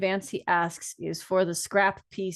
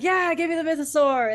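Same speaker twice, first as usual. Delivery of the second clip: high, loud eager cry; excited shout; 8 semitones higher, 5 dB louder than usual. Retold or imitated. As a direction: yell it like a thrilled fan. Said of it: "I'm literally like".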